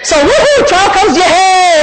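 high, whooping mock celebration; joyful cheering. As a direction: cheer as if trouble were great news. Acts out cheering when trials come.

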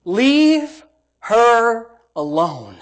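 very slow, firm command; commanding Jesus. Acted. Jesus silences the critics with a slow firm command. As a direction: lay it down slow and final. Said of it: "Jesus said in verse six".

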